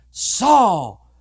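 grave, stretched calling of the name; great voice from heaven. Acted. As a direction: let the summons drop into solemnity. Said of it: "a great voice came out of heaven".

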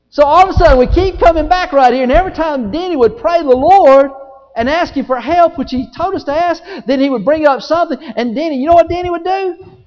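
high, loud, driving sermon; full-force preaching. Real, not acted.

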